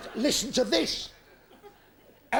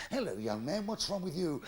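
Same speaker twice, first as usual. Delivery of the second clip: vicar voice; 4 semitones lower, 7 dB quieter than usual. Acted. Comic voices vicar in joke.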